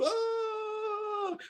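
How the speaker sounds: sudden high wailing "oh"; mock anguished cry. Preacher acting out a panicked inner voice, high mock wail. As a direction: let out a pretend wail of distress.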